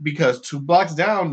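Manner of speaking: ordinary talk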